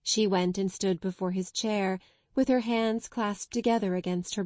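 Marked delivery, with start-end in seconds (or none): none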